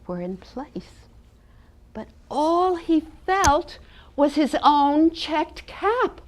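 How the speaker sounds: lively storybook reading for a class; reading to children. A narrator's reading. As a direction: read it like a storyteller to little ones. Teacher reads picture book aloud.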